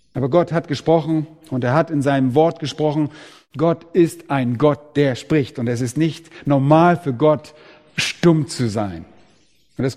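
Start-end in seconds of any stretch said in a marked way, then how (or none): none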